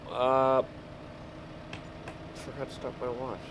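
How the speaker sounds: ordinary talk, nothing marked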